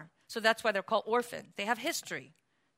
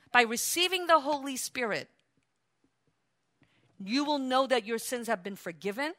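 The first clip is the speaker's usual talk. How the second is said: ordinary talk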